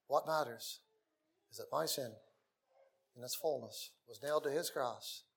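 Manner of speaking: ordinary talk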